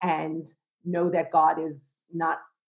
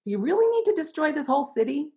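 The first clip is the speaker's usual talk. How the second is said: much higher, incredulous pleading; Avraham pleading with God; 10 semitones higher, 3 dB louder than usual. Retold or imitated. Avraham arguing with God, pitched high and pleading. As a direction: challenge God, almost as an equal. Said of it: "and him saying".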